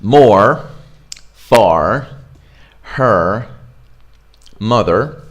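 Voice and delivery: American English, R sounded at each word's end; American accent. Shown as a demonstration. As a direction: separate words with a full American R. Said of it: "that's not what we're learning here".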